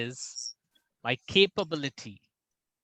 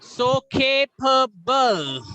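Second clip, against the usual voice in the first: capable drawn out, higher, stress on the first syllable K; slow stressed capable; 7 semitones higher, 5 dB louder, much slower than usual. Shown as a demonstration. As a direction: say capable slowly with a hard K at the start. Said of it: "there are three syllable".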